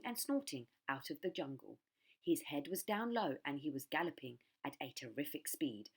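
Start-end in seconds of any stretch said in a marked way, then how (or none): none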